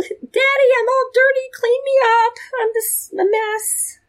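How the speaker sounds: put-on high-pitched little child begging daddy; pleading child. Preacher voicing a child asking daddy to clean them up, high and pleading.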